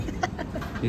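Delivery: quiet, voiceless chuckle; laugh. Real, not acted. Laughing at his own burger-price joke, softly. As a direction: laugh softly under the breath.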